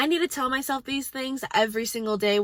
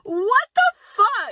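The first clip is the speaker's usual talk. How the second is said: high, loud disbelieving outburst; outraged; 10 semitones higher, 6 dB louder, slower than usual. Real, not acted. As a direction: explode in disbelief. Outraged at debate claims.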